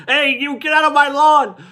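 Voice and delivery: high mock shout at the kids; cranky old man yelling off the lawn; grumpy old man yell. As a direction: shake your fist and holler like a cranky neighbor. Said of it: "just yell at him, shake my fist".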